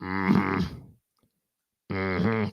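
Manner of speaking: low Sling Blade mm-hmm grunt; low throaty character grunt. Retold or imitated. Showing the Sling Blade voice with a low grunt. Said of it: "Sling Blade is kinda like this".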